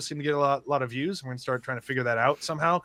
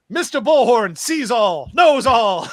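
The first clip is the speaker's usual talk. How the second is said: slow, loud, higher hammed-up shout; grand mock proclamation; 8 semitones higher, 9 dB louder, slower than usual. Retelling Mike's bullhorn joke, slow hammed-up shout. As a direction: proclaim it slowly like an all-seeing god. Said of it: "And then he goes".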